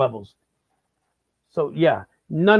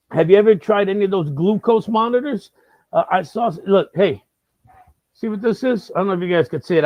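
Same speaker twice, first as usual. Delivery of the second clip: normal talking voice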